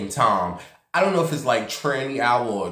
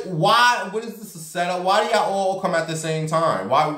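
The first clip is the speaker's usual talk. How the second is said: exasperated; 7 semitones higher than usual. Retold or imitated. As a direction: pitch it up in mock outrage. Exasperated as another man approaches.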